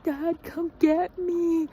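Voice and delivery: put-on high, slow childish whine; whiny plea. Sick girl whining for her dad to fetch her, high and slow. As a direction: drag it out like a sick kid.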